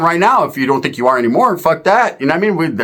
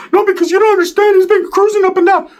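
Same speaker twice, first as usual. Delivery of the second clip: high squeaky Ricky; 14 semitones higher than usual. Retold or imitated. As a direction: pitch it way up and protest. Ricky ranting again.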